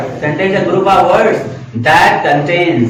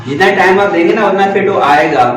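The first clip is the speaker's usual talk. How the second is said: ordinary talk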